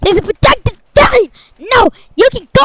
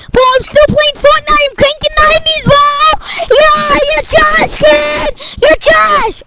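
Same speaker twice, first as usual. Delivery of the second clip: put-on higher, brash gamer kid trash-talking; hyped gamer kid; 7 semitones higher than usual. Son playing Fortnite taunting another kid, higher and brash. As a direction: crow over the game like a cocky teen.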